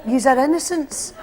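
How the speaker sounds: ordinary talk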